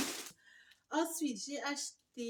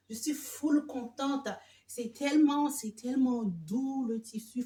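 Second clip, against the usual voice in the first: gushing excitement; 11 dB louder than usual. Real, not acted. Thrilled unboxing her Temu haul.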